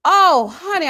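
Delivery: loud, slowed mock mourning; mock wailing grief. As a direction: ham up his crocodile tears. Mocking his fake grief.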